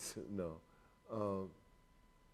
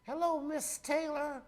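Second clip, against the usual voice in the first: insurance telemarketer; 13 semitones higher than usual. Retold or imitated. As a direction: mimic a fussy insurance caller, pitched way up. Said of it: "when I get a call and goes".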